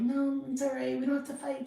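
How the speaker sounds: high, meek peacemaking; mock timid girl voice. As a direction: sound like the shy girl avoiding fights. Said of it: "one of those quiet".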